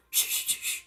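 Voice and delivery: whispered, repeated shush; urgent shushing. Retold or imitated. Friend silencing the room, hushed shushing. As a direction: hush them with soft bursts of air. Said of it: "And we go quiet".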